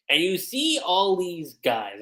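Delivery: ordinary talk, nothing marked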